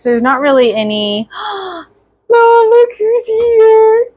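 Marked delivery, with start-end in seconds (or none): 1.3-1.8 sharp delighted gasp; surprised gasp. Real, not acted. Baby arrives, surprised gasp. 2.3-4.1 high, slow, delighted. Real, not acted. Baby brought in, calling out.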